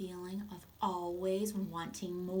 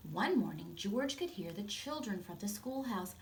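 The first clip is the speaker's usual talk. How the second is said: usual speaking voice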